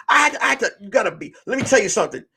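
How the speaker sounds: ordinary talk, nothing marked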